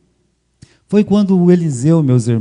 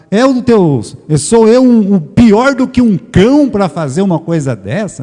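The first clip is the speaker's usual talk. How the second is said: acted indignant protest, high and raised; mock affronted outburst, pitched up; retold offence; 5 semitones higher, 3 dB louder than usual. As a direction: play a man offended by the accusation. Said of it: "ele se ofende".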